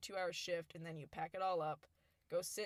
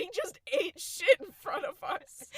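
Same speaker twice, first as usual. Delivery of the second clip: loud, high retold outburst of disbelief; incredulous retort; 12 semitones higher, 14 dB louder than usual. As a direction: cry out as if mom is being absurd. Retelling incredulous retort to mom.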